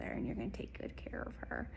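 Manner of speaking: hushed and tender; soft whisper. Real, not acted. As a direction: breathe it out like a private promise. Tender promise to the angels.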